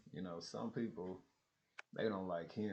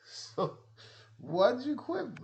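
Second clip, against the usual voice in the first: high, loud, slow disbelief; incredulous question; 8 dB louder, slower than usual. Retold or imitated. To the quitter, slow loud disbelief. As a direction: drag out the disbelief.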